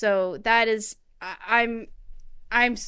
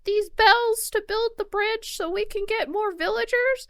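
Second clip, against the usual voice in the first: much higher, sing-song shopkeeper voice; mocking Tom Nook; 12 semitones higher than usual. Mocking the raccoon landlord asking for bells, high sing-song. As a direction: ape the raccoon's sugary sales pitch. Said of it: "he's in his fucking town hall just like".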